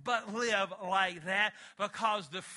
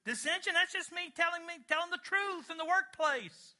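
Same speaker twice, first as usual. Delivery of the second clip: higher, mocking voice of someone excusing their sins; mock self-justifying sinner; 7 semitones higher than usual. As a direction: parody the excuse-maker, raised and sing-song.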